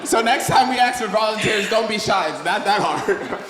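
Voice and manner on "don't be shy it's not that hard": usual speaking voice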